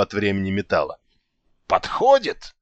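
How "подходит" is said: high pointed exclamation; meaningful. A narrator's reading. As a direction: announce it with heavy significance. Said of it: "многозначительно сообщил Алекс".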